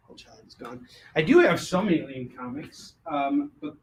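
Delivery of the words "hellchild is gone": whispered aside, voiceless. Shown as a demonstration. Seller whispering the sold-out title to himself. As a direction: breathe the words without voice. Repeats it, second time whispered.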